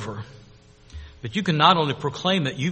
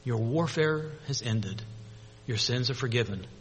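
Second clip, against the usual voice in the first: soft, hushed reassurance; gentle comfort; 4 semitones lower, 9 dB quieter than usual. Acted. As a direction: comfort them softly, leaving room between phrases. Gentle words after everything's quiet.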